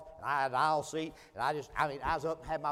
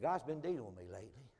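hushed and much softer; confiding quietly; 4 semitones lower, 8 dB quieter than usual. Retold or imitated. Stranger confessing his past to the preacher, quiet and confiding. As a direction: confess it low and personal. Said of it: "his tone began to change".

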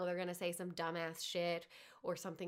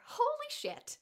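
very high, louder shocked squeal; startled squeal; 6 dB louder, slower than usual. Real, not acted. Joking about crying at work.

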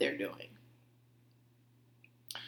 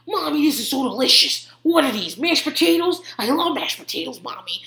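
raised, eager mock-kid voice; imitating delighted toddler; 5 semitones higher than usual. Three-year-old praising mommy's dinner, toddler imitation. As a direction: gush like a small boy at dinner. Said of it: "eat it and be like".

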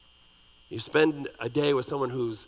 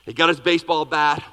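usual speaking voice

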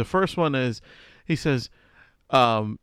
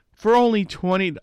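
charity ad voice; 6 semitones higher, 5 dB louder than usual. Retold or imitated. Mimicking charity commercial.

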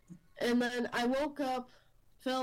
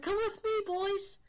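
put-on much higher, urgent call to the boys; frantic impostor mom, voice jumped high; fake mom's urgent call; 10 semitones higher than usual. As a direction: pitch it way up like a frantic mom hurrying kids. Disguised fake mom urging boys.

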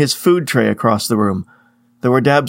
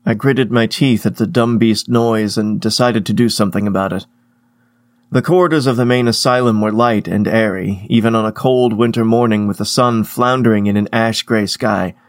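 ordinary delivery, nothing marked

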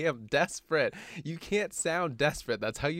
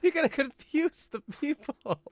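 high, laughing protest; 13 semitones higher, 4 dB louder than usual. Real, not acted. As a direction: squeal it out while laughing.

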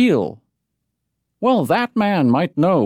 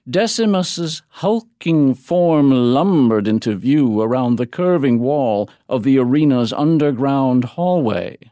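ordinary delivery, nothing marked

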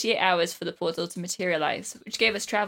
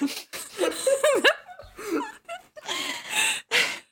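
high-pitched helpless laughter; real giggling fit that trails on; long laughing fit. Real, not acted. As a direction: crack up mid-read. Reader cracks up mid-reading.

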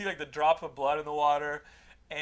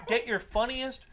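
usual speaking voice

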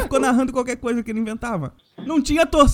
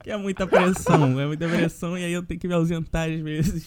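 low, monotone, deadpan announcement; put-on solemn voice, pitched low and level; mock-solemn statement; 10 semitones lower than usual. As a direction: deliver a grave official statement, tongue in cheek. Mock-solemn statement on his absence.

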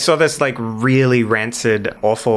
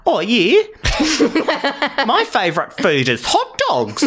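put-on playful New Zealand accent, pitched up; 11 semitones higher than usual. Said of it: "I'll try and go New Zealand".

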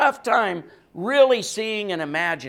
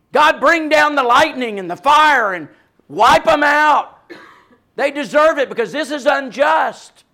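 loud, high shout for lightning and fire; angry outcry; 6 semitones higher, 8 dB louder than usual. Pretend. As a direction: rage like a wronged man demanding vengeance. Angry call for vengeance.

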